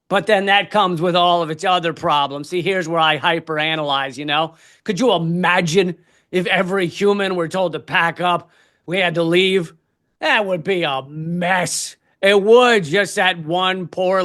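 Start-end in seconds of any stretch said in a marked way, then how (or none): none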